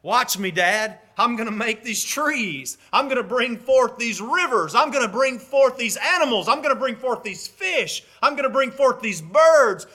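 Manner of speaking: high, building, eager list; acted childlike delight, pitched well up; excited child showing off. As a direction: show off to Dad with mounting excitement. Son showing Father his creations.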